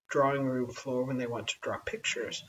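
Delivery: usual speaking voice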